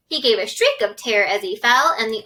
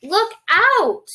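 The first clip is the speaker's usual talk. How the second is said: high, loud alarmed shout; shouted warning; 9 semitones higher, 4 dB louder than usual. Acted. James yelling at the centipede, loud and high. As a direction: yell it to stop him falling. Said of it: "cried James".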